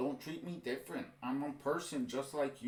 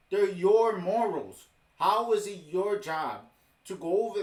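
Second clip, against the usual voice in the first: heated stress; 4 semitones higher, 7 dB louder than usual. Real, not acted. Ranting about imposed morals.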